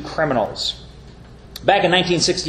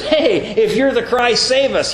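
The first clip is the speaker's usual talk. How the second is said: higher mocking jeer; sarcastic taunt; 8 semitones higher than usual. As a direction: jeer up at him like a heckler. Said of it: "saying that with sarcasm".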